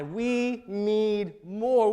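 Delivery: weighty, stretched-out declaration in God's words; drawn-out emphasis. Acted. God's demand, drawn-out retake.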